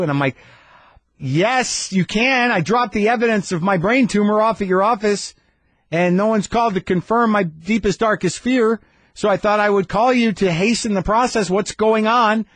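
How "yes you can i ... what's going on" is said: high-pitched exasperated demand; pointed complaint, pitched right up; indignant. Retold or imitated. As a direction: demand answers with mounting impatience. Imagined indignant phone call to receptionist.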